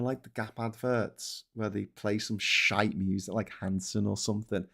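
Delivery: ordinary delivery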